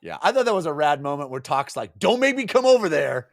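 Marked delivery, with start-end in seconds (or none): 2.0-3.3 higher-pitched scolding warning; mock threat. Talk warning her from afar, mock threatening. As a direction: warn them like an exasperated parent.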